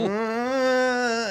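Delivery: long high mock whine; high whiny groan, imitated; whining. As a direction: imitate a drawn-out sleepy whine. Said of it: "whining at me".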